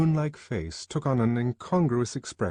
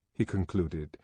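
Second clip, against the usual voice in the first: plain reading voice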